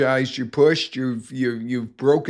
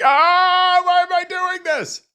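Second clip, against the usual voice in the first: very high, loud shrieking wail; mock scream of fear; 5 dB louder than usual. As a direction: shriek it like a terrified rider. Retelling roller coaster fear.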